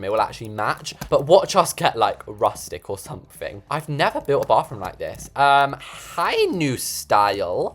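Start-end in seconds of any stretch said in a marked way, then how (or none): none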